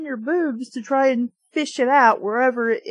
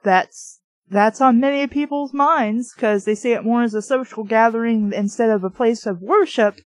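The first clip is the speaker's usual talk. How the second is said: ordinary delivery, nothing marked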